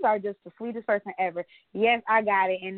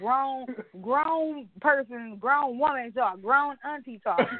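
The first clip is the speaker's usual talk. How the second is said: dragging out grown; slow emphatic grown; 2 semitones higher, slower than usual. Real, not acted. Teasing her friend about the grown auntie message, slow and emphatic. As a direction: lean on each grown, slow. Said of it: "you were extra dramatic".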